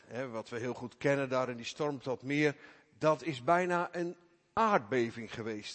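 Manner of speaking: normal talking voice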